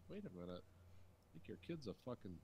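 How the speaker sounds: ordinary delivery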